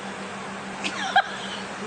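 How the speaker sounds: wheezing real laugh; high breathless laugh. Real, not acted. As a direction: squeal of airy laughter. Laughing at slippery banana.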